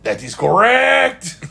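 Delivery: slow, high-pitched, hammed-up French accent; mock French accent. Host confirming the plug, in a drawn-out mock French accent. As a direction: stretch it out like a gleeful cartoon Frenchman. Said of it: "doing French accents for everybody's voice".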